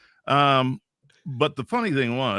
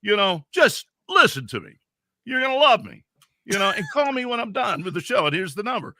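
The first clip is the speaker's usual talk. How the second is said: retold cocky insistence; 9 semitones higher, 5 dB louder than usual. Retold cocky pitch on phone.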